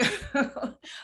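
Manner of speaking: real, high laugh. Real, not acted. Host amused by her guest's agreement, laughing. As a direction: burst out in a loud laugh. Co-hosts joking as they wrap up.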